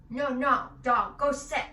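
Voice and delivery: put-on loud bossy toddler yelling at dogs; mock scolding shout. Toddler yelling at the dogs, loud and bossy. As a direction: bark the order at the dogs. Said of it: "he screams at them".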